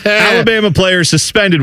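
mock sports news teaser; clickbait headline. Shown as a demonstration. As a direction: hype the headline as bait. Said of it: "I wanted to tease Alabama college players".

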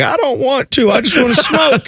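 high-pitched put-on protest; reluctant old singer imitation. Host acting an old singer who won't go onstage, mock reluctant. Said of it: "And he's like".

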